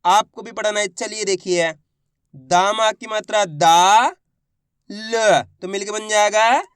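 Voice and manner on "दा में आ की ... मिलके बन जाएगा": slow sounding-out, दा held long and rising; syllable-by-syllable demo. Shown as a demonstration. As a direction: separate ल, then lead up to the blend. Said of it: "मैं किस तरीके से एक एक अक्षर का उच्चारण".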